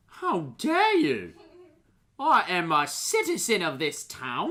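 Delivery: high, loud, affronted townsman; indignant outrage. Acted. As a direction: protest in wounded dignity. Outraged townsman answers insult.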